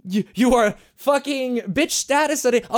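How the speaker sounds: ordinary delivery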